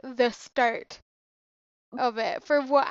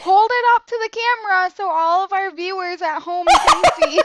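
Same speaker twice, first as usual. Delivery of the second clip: higher, louder vlogger host; mimicking a child vlogger, higher and louder; YouTuber voice; 6 semitones higher, 8 dB louder than usual. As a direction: present to the camera like a YouTuber. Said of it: "So she's like".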